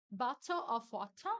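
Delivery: bottle of water with every T hit hard, bato of wota; sharp British T in bottle of water; crisp British T. Shown as a demonstration. Teacher contrasting British extremes, crisp T. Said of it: "não vai falar com som de R".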